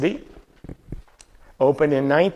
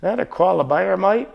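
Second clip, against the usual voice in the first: mock Australian accent; 6 semitones higher than usual. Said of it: "put on your Australian accent".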